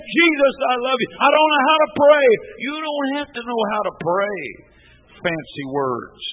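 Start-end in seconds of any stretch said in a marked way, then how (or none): 0.1-2.3 loud, higher cry of a plain prayer; simple loud prayer. Retold or imitated. Preacher voicing a worshipper's first prayer, loud. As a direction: call out to Jesus plainly. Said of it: "when we begin to say".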